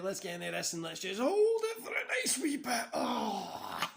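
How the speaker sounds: high put-on wrestler voice, strained; imitating Scott Hall. Streamer imitates wrestler holding a stretch, high mock voice. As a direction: voice the wrestler mid-hold, mocking. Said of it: "he always does that as if it's like".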